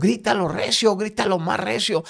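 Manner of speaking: high, loud retold command; urging shout. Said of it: "me gritó aquel hombre".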